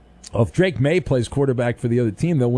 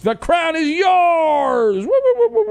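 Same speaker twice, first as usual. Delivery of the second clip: excited shout; 13 semitones higher, 4 dB louder than usual. Acted. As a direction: belt it like a hype man. Ad read, big shout.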